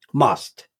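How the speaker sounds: a lone, emphatic must with a falling tune; stress on must. Real, not acted. Insisting to Linux beginners, must said as a verdict. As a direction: let must stand alone, final and firm. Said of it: "I use the word must here".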